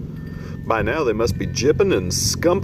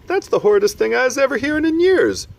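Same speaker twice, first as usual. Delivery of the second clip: much higher and louder outrage; outraged giant; 5 dB louder, faster than usual. Acted. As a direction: keep up the indignant outburst.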